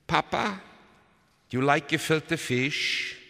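put-on high, loud child's question; high-voiced, leading question; little girl. A narrator's reading. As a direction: ask father slowly in a small child's pitch. Little sister questions father.

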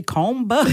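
"comba" as comb with its B sounded, COME-buh. Shown as a demonstration. Mother teasing her daughter about spelling, "comba" with the B sounded. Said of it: "comb is spelled with a B at the end".

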